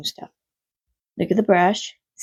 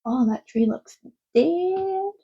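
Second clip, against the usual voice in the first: surprised; 9 semitones higher, much slower than usual. Real, not acted. Sudden remark looking outside.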